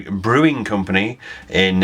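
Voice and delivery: normal talking voice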